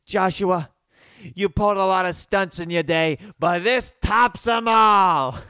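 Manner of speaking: mother shouting that this stunt tops them all; mad mom. Retold or imitated. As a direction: blow up at him like a fed-up mom. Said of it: "then she gets so mad".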